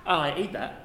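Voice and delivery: ordinary talk, nothing marked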